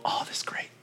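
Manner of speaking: hushed whisper for the peaceful quiet. Pretend. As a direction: whisper it as though not to break the silence. Describing peaceful quiet reading time.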